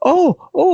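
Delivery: high, loud breathy "oh, oh" of a woman overcome; imitated emotional moans. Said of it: "like that".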